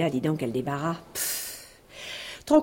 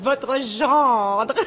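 normal talking voice

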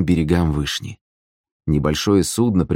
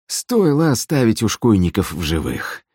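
higher, effortful puffing speech; heavy exhaling; 5 semitones higher than usual. A narrator's reading. Gusli player straining at the oars, heavily exhaling. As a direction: push the words out on heavy breaths. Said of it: "тяжело выдыхая".

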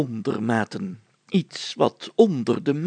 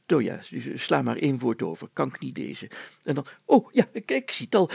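usual speaking voice

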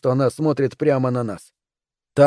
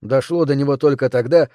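usual speaking voice

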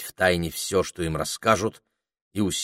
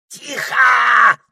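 very high, loud yell; the parrot shouting the children down; shouted hush; 7 dB louder than usual. Acted. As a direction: bark for silence. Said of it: "закричал попугай".

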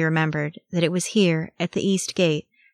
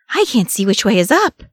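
high, loud, flustered complaint; gasping; 4 semitones higher, 6 dB louder than usual. A narrator's reading. Sam is lost amid the hullabaloo, gasping. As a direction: gasp it out in confusion. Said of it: "Sam gasped".

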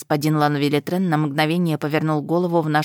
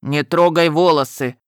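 louder man's baritone demand; 5 dB louder than usual. A narrator's reading. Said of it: "заговорил мужским баритоном".

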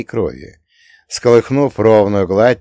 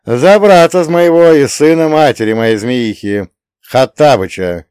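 loud, higher proud declaration; 4 semitones higher, 7 dB louder than usual. A narrator's reading. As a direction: declare it loud for your brother. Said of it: "ответил повелитель пекла".

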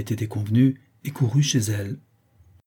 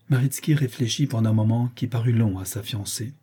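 usual speaking voice

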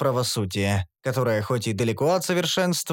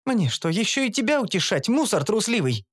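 higher, scornful elf voice, мусор spat out with a falling tone; contemptuous elf; 7 semitones higher than usual. A narrator's reading. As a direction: sneer мусор трусливый at him. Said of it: "процедила эльфийка". (эльфийка презрительно цедит)

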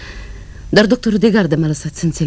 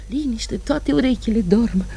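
ordinary talk

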